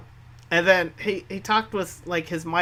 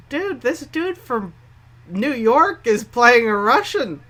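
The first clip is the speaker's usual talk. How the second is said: mock outraged fan; 12 semitones higher, 8 dB louder than usual. Mocking fans' complaint about promo.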